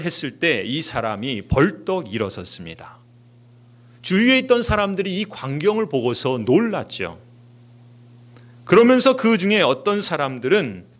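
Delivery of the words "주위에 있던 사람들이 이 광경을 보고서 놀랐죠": ordinary talk, nothing marked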